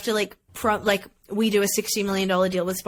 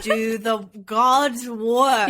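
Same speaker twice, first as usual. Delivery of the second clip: slow, mock-solemn praise; much slower than usual. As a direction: draw it out like a eulogy.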